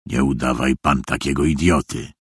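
a hint of a bloodthirsty official, low; low, contained threat; menacing official. A narrator's reading. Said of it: "Krwiożerczo spojrzał na Szwajka i rzekł".

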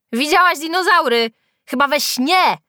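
loud, high-pitched taunt; mocking jeer. A narrator's reading. Classmates ridicule Julka's claim, jeering loudly.

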